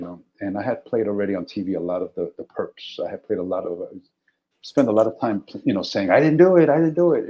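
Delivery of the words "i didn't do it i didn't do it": higher, louder plea of innocence; acted protest from someone being arrested; protesting suspect. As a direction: blurt out a suspect's denial.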